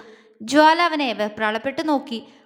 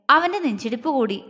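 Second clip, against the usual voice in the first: plain reading voice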